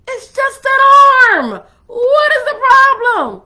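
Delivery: acted high, loud, slow exclamation; hammed-up bewildered shouting; exasperated protest. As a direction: cry out in baffled disbelief, stretching the words. Acting out partner's baffled reaction.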